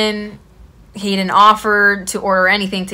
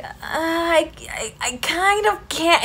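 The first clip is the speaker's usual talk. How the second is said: hesitant whisper; 9 semitones higher, 6 dB quieter, slower than usual. Retold or imitated. Can't refuse his bar invite.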